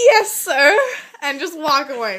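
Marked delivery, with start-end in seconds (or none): 0.1-0.8 high, loud reply through laughter; laughing mock compliance. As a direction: act out compliance while cracking up. Acted meek reply, laughing.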